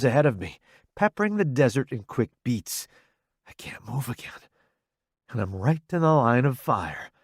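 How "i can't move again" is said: whisper. A narrator's reading. Frozen in fear under fire.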